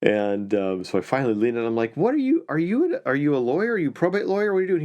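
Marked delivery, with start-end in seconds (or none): 2.0-4.8 amazed. Retold or imitated. Lawyer leans in, amazed by stranger.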